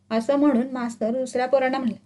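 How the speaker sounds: ordinary delivery, nothing marked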